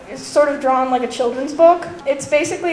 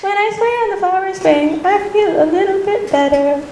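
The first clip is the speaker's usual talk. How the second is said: higher, lightly acted girlish manga voice; manga heroine; 6 semitones higher, 3 dB louder than usual. Quoting a sentimental manga heroine.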